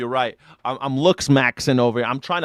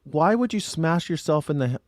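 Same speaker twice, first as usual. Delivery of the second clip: ordinary talk, nothing marked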